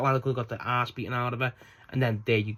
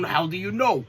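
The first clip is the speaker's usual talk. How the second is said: higher, mock taunting question; Andrade; 5 semitones higher than usual. Andrade rage baiting Max, mock taunt. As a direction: needle him with an acted, raised-pitch comeback.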